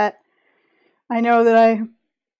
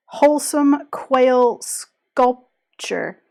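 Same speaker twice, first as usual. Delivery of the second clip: slow careful enunciation; much slower than usual. Real, not acted. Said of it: "is a difficult thing to attempt to say".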